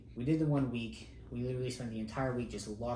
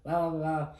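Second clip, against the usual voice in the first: louder, higher mock drill-instructor yell; retold wake-up yell; 5 semitones higher, 6 dB louder than usual. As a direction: bark them awake like an instructor, retold. Instructors banging on dorm doors.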